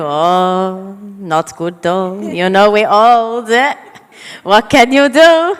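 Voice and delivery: put-on loud Maltese grandma accent. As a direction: lament it in a thick Maltese accent. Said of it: "take off my other grandma in Maltese".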